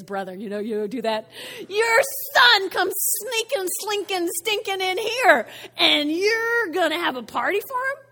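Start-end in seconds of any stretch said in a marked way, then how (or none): 1.7-8.0 high, loud, indignant accusation; letting loose. Acted. Older son complains to father about brother, loudly indignant. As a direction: heap on the scorn, loud and pitched up. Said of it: "the son just lets loose".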